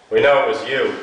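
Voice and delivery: loud, sure of the guilt, pin it on her; a police officer booming an accusation at a suspect; hefty confident cop. Acted. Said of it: "hefty, confident voice said".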